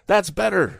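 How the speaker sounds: high, strained shout. A narrator's reading. Tom shouting to the crouched woman, high shout. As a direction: call out encouragement over the noise. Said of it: "shouted Tom".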